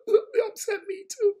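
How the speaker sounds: high, loud, slow hammed-up crying wail; mock whimper. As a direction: pretend to sob like the upset girls. Rabbi jokingly joins the crying girls.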